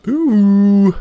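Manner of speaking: high, loud admiring ooh; delighted ooh. Real, not acted. Player gushing over a pretty shield, high and loud. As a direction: swoon over it.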